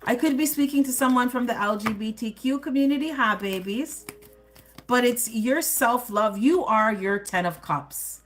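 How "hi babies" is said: usual speaking voice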